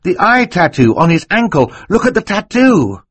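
put-on high, loud, urgent boy's cry. A narrator's reading. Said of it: "Klaus cried".

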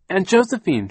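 high, loud searching call, falling at the end. A narrator's reading. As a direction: call out for a missing aunt. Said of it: "she called".

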